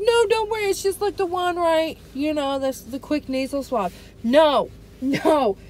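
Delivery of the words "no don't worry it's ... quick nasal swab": mocking the doctor. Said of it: "He's like".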